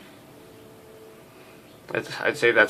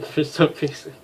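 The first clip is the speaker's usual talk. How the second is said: usual speaking voice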